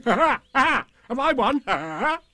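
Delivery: cheerful, high tiger laugh and cry; Tigger. A narrator's reading. Tigger emerging from the tablecloth after a struggle, cheerful and high. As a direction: crow excitedly between bursts of laughter. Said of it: "said cheerfully".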